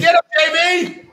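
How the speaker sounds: very high, excited whoop; excited high-pitched call; hyped-up cheer. Real, not acted. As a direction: egg your co-host on in a high voice. Hyping up the co-host's rant.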